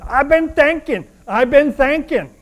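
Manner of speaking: put-on high, loud little girl with a Tennessee drawl; little girl's Tennessee drawl. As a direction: pipe up loudly in a child's Tennessee drawl. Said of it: "her sweet little Tennessee drawl".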